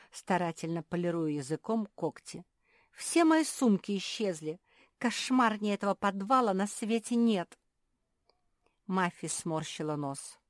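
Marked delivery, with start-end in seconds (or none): 2.9-7.6 higher, louder mild wail; lamenting. A narrator's reading. Marsia bewailing her vanished bags, lamenting. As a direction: complain as if all is lost. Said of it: "запричитала Марсия".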